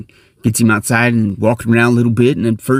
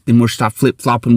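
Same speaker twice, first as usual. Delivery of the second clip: ordinary talk, nothing marked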